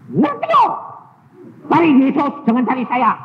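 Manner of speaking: high loud exasperated retort; snapping back. Retold or imitated. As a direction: snap back at her. (balasan kesal dengan keras)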